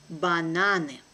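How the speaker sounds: brisk, natural native speed; quick native pace. Shown as a demonstration. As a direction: say it fast like a native. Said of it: "second time will be quickly, like a native".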